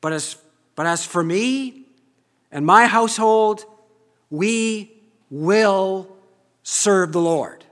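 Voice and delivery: loud, rising proclamation; Joshua's declaration. Acted. Joshua vowing before the people, loud proclamation. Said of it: "Joshua says".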